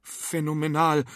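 put-on faintly hesitant amazed boy; stammering. A narrator's reading. Arthur amazed by the witch's trick, lightly stammering. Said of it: "stotterte Arthur".

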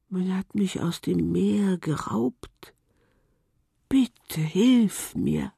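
slow, pleading whisper; slow unvoiced whisper, begging. A narrator's reading. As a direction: whisper a plea for help, very slowly. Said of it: "flüsterte die Muschel".

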